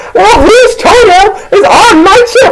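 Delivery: very high mock wail; hammed-up falsetto demand; upset friend. Homie asks whose toenail it is, wailing.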